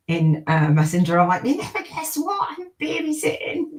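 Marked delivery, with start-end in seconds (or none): 1.4-3.8 high squealing excitement, imitating herself; ecstatic squeal. Retelling her message to soul sisters, high ecstatic squeal. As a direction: squeal the news like a giddy kid. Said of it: "just being ecstatic and squealing".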